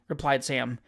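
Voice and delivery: usual speaking voice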